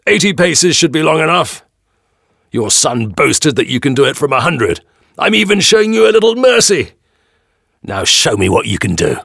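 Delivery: high, loud tyrant's shout; calling out. A narrator's reading. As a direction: lord it over the crowd at full voice. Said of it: "Gessler calls out to the crowd".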